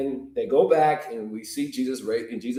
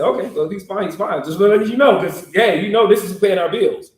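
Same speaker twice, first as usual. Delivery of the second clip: high, louder as it goes; laughing insistence; 7 semitones higher, 6 dB louder than usual. Acted. Disciples reminding Jesus who pays, high and pushy. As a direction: laugh it off then push.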